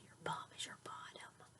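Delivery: whisper. Real, not acted. Whispering a cheeky word to child.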